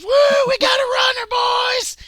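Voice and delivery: whooping high shout down the corridor; gleeful yell. Acted. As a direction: holler it out as he bolts off. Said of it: "Pete goes".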